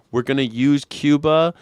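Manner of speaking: ordinary talk, nothing marked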